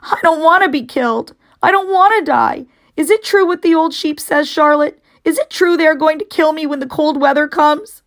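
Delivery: high, loud panicked pig; frantic pleading. Acted. Wilbur frantically asks Charlotte if it is true. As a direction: plead for the truth in terror.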